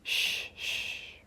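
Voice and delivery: soft, unvoiced hush; calm shushing. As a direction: hush him gently like a frightened child. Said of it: "Remus says calmly".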